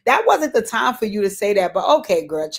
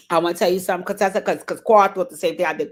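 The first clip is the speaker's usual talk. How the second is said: normal talking voice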